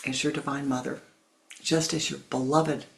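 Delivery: ordinary talk, nothing marked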